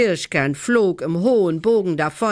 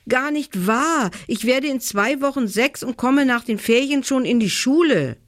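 put-on mildly indignant, higher-pitched child; indignant protest; 9 semitones higher than usual. A narrator's reading. Little brother objects to being called a small child, indignant.

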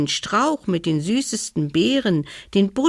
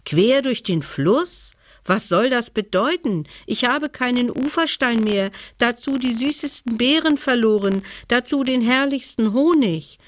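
a touch higher, fast, lightly voiced bear complaining; upset bear; 6 semitones higher than usual. Bear to the stag, upset and higher. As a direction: let the bear pile up his losses. Bear lists what he lost.

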